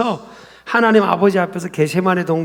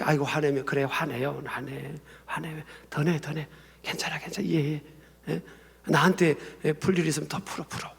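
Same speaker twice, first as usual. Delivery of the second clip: much softer, lower reassurance; gentle soothing; 4 semitones lower, 11 dB quieter than usual. Acted. Listener telling an upset person to let it out, gentle soothing. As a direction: soothe them and let them vent, very quietly.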